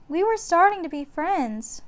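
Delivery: put-on young girl puzzled; little girl. A narrator's reading. Annie wondering to Jack, high girlish voice. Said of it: "said Annie".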